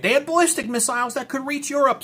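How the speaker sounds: high-pitched mock alarmist voice; whiny-high caricature of a worrier; mock objector. Voices objectors he then dismisses.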